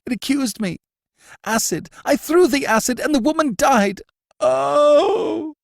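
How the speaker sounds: high, loud, wailing confession; a prisoner crying out his crime in terror; terrified wail. A narrator's reading. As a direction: break into a shuddering wail of terror. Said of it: "long, shuddering wail of terror".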